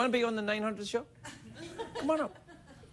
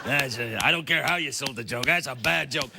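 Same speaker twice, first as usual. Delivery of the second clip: much lower, grumbling heckler; grumpy audience guy; 7 semitones lower than usual. Retold or imitated. Audience guy to host, lower grumble about the bad joke. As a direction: grumble like an unimpressed punter. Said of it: "a guy over there going".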